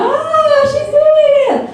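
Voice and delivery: high-pitched mock cheer; hammed-up, very high squeal of approval; delighted students. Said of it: "They'll be like".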